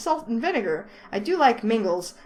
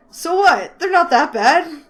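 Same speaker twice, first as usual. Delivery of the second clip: louder, higher, slow huffy denial; defensive loud retort; 7 semitones higher, 8 dB louder, slower than usual. Acted. Ted defending his uniform, loud and huffy. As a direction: snap back defensively.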